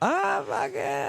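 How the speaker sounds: very high, slow Nordeste whine; mock lazy Nordeste speech, barely articulated; slurred Nordeste drawl. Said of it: "They can't even articulate what they're saying".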